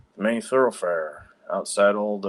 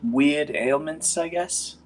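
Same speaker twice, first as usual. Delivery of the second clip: a hint of a timid healer; slightly higher, hesitant woman; lightly voiced young woman; 5 semitones higher than usual. GM voices NPC Augusta.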